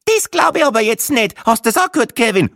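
very high, loud indignant voice; outraged Austrian tourist. Acted. Gruberin outraged, turning to her husband, very high. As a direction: squawk in disbelief at her husband.

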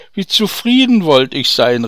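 a loud, yearning cry; calling out. Acted. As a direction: fling the wish out loud. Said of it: "rief der Kornhändler".

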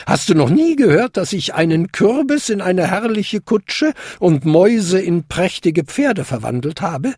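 put-on high, boastful old lady; lively high-pitched godmother voice; fairy godmother. A narrator's reading. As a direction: brag grandly of your magic. Godmother boasts of famous magic.

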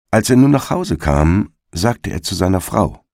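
ordinary delivery, nothing marked

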